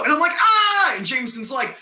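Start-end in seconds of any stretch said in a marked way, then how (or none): 0.3-0.9 high, loud shriek; panicked scream. Acted. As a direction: scream at being caught. Acts out own terrified scream.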